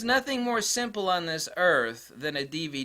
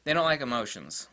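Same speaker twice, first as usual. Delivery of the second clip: ordinary talk, nothing marked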